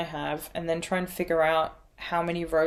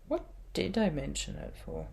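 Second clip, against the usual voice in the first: ordinary talk, nothing marked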